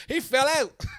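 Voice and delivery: words through real laughter; cracking up while telling it; laughing. Real, not acted. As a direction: laugh it out, high and loud. Laughing while retelling the win.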